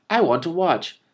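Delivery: put-on slow, high wheedling boy; whining young prince. A narrator's reading. Young prince whines that he wants to watch. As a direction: whine for your way.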